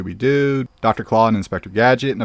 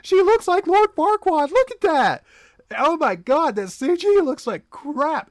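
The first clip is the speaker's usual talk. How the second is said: much higher, astonished ranting; shocked outburst. Real, not acted. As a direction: yelp in horrified disbelief. Outraged by the remake's CG.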